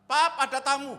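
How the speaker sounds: imitated urgent call; calling out to dad. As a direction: call out to father that someone has come. Child calls father about a guest.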